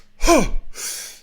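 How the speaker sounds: loud forced exhale through the mouth; forceful breath demo. Shown as a demonstration. Showing the audience his diaphragm breathing, loud forced exhale. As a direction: blast the air out hard from the diaphragm. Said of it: "which looks like this".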